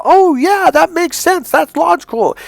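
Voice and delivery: put-on high, loud, delighted girlish "oh yeah"; impressed woman's voice. Coach voicing an impressed woman, high and delighted. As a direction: play the woman suddenly won over.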